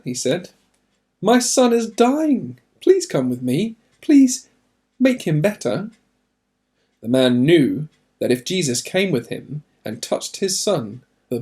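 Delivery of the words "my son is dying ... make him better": high, pleading voice of a frightened father; desperate plea. Acted. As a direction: beg as if time is running out. Ojciec błaga Jezusa o pomoc.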